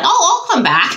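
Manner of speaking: high-pitched mock spirit voice; lightly acted, high chirp; imitating a spirit. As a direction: pipe up as the eager visitor. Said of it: "Okay, she's like".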